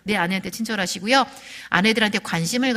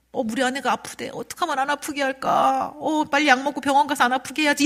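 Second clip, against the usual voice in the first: higher-pitched fretful inner voice; acted worry; 5 semitones higher than usual. Husband hearing his wife is sick, fretting. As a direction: play an anxious, fussing husband.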